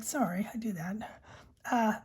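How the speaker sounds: ordinary delivery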